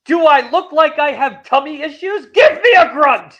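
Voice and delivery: loud, high, overbearing boss voice; booming demon lord. Retold or imitated. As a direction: bark orders like a tyrant. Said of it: "and he says".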